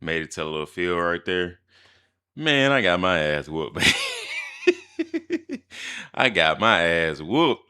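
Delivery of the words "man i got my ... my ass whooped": loud, slow punchline broken by a big laugh; the punchline of a story about losing a fight; laughing shout. Real, not acted. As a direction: belt it out slowly, then crack up.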